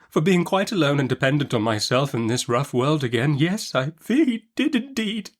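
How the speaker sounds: a touch of tears in the voice; sobbing. Acted. Widowed mother to Betsy, mildly sobbing. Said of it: "sobbed my mother".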